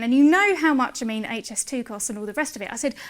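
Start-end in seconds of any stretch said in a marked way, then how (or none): none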